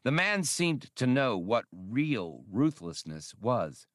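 ordinary delivery

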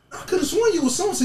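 playful high imitation of her; high mock woman voice. Retelling the woman at the club, in a high voice. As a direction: pitch it up high like the confused woman.

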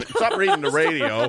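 loud, high scolding through laughter; laughing scold. Acted. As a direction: scold while cracking up.

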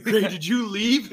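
imitating a friend who looks like he's crying; mock crying whine. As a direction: ham up a teary late-night selfie. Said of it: "It looks like you're crying".

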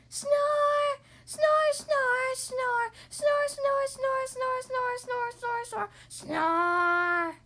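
high, loud comic snores; loud snoring. Acted. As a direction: snore as loud as a cartoon pig. Piggy snoring loudly.

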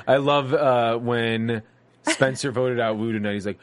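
ordinary delivery

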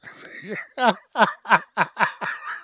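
high breathless giggling after a flubbed word; helpless high giggle; giggle fit. Real, not acted. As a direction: crack up at your own slip. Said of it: "I'm leaving that in".

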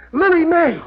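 high surprised cry; startled to hear her; Riley surprised on the phone. Acted.

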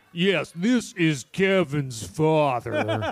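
slow, drawn-out slowed-tape voice; badly faked dad voice on a slowed recorder; slowed-recorder fake father. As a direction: imitate a tape played at half speed. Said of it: "he speaks into a recorder".

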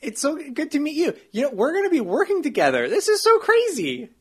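high, fast, gushing excitement; overexcited. Acted. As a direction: gush with over-the-top enthusiasm. Overexcited deputy mayor greeting.

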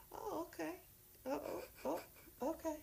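high, soft hurt little oh okay; a light imitation of being taken aback; hurt mock surprise. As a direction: act stung but brushing it off. Retold hurt reaction to the brush-off.

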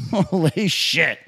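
slow, drawn-out exclamation; slow stunned. Real, not acted. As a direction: stretch it out in disbelief. Repeated slowly, stretched.

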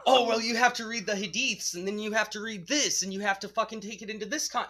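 high, loud mock explaining; mocking believer voice. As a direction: parody a preachy apologist. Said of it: "mocking believers' argument".

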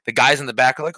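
ordinary talk

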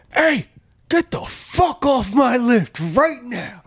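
high, slow, drawn-out furious demand; angry old man. Retold or imitated. Old man yelling at the coworker to get off his lift. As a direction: order him off the lift, seething. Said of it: "he's like".

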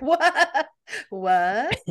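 incredulous What, broken by laughter; laughing disbelief. Retold or imitated. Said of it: "laughing at him".

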